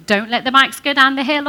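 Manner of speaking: "Don't let the bikes go down the hill" snapped out loud; negative command. Shown as a demonstration. Preacher contrasting the negative phrasing, loud and sharp. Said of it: "Different thing".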